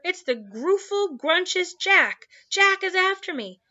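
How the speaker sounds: high, loud giant in panic; terrified high-pitched ranting; bellowing in terror. A narrator's reading. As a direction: wail and bellow at full pitch. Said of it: "bellowed the flesh lump eater".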